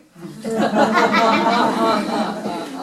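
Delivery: big loud burst of laughter; hearty laugh. Real, not acted. Telling the audience her story, loud hearty laugh.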